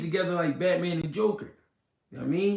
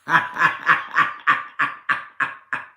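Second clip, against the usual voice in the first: real laugh; 4 semitones lower than usual. Real, not acted. Cracking up at a viewer's comment, laughing. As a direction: laugh it out in short bursts.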